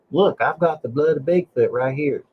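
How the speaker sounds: light West Virginia twang on a quote; West Virginia accent. Retold or imitated. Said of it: "You said it with an accent".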